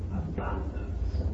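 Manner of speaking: slow whispered "Abundance"; hushed awe. Real, not acted. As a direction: breathe out "Abundance" without voice, savouring it.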